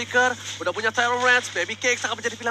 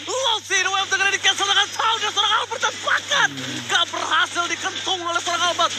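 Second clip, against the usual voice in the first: excited; 7 semitones higher than usual. Real, not acted. Caster hypes the play.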